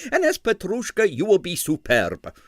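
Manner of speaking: a hint of high coaxing impresario; wheedling, persuasive. A narrator's reading. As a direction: still coaxing the dancer. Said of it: "he said coaxingly".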